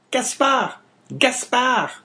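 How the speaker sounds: a wife urgently hushing her husband; wife's warning. Acted. Wife warning Caderousse from the stairs, high and urgent. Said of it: "murmured the woman from her seat".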